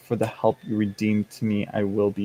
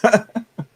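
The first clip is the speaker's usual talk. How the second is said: high squeaky laugh; 11 semitones higher, 5 dB louder than usual. Real, not acted. Laughs at dog sound.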